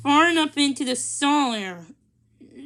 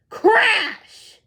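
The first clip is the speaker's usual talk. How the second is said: crash; 10 semitones higher, 8 dB louder than usual. A narrator's reading. Sound effect in narration.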